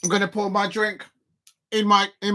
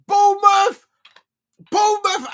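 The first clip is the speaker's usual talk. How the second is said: excited; 11 semitones higher, 8 dB louder than usual. Real, not acted. Reading the draw result.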